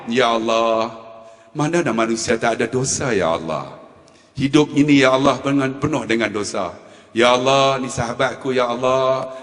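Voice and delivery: low, monotone praying voice; prayer. Retold or imitated. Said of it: "saya doa". (suara berdoa yang rendah)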